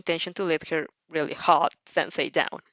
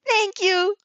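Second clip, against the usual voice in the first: high, loud burst of thanks; happy exclamation; 13 semitones higher, 4 dB louder than usual. A narrator's reading. Mina thanking while hugging both girls, joyful exclaim. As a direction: squeal your gratitude. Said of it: "Min exclaims".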